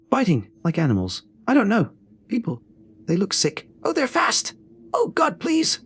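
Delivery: rising terror, begging to be let in; panicked pleading. A narrator's reading. Woman at the locked door pleading with the clerk, rising panicked cry.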